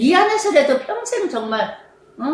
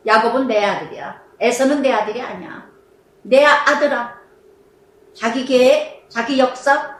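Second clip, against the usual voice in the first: ordinary delivery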